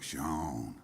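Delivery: lightly imitated low country South Carolina accent; a hint of an old-school Myrtle Beach South Carolina accent. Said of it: "low country South Carolina accent".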